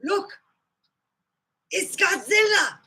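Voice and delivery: high-pitched monster sighting; old Godzilla movie cry. Acted. Showing seventies Godzilla movies, high startled cry. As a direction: call out like a startled movie extra. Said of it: "This is how it was".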